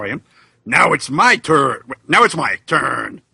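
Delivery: normal talking voice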